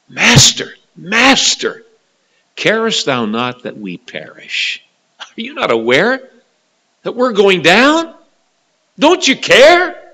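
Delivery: high, loud desperate plea; panicked cry. Acted. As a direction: beg the sleeping master to wake. Disciples cry out in storm.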